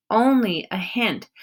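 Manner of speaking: plain reading voice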